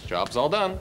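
ordinary talk, nothing marked

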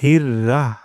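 হির রাহ clipped, with a long রাহ; wrong reading demo. Shown as a demonstration. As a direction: show the mistake হির রাহ. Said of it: "আর ভুলটি হলো". (ভুল উচ্চারণ হির রাহ)

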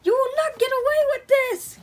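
high, loud play-acted outcry; defiant shout. Toy hero confronts the money thief, defiant high shout.

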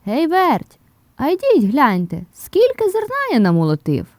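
loud, high-pitched rooster holler; calling out. A narrator's reading. Rooster calling the mice to see the threshed grain, hollering. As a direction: shout for them to come and look. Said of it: "гукає".

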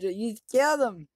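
high, loud accusing shout; accusing yell. Real, not acted. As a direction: hurl the blame at him. Accuses after the kill.

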